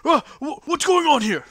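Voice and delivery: high, loud, stammered alarm; shouted, high-pitched shock; startled dwarf. Acted. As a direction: jump as if caught in the act. Dwarf startled as door swings open.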